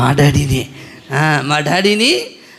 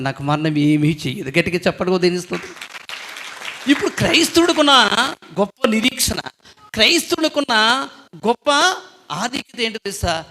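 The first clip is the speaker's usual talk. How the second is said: usual speaking voice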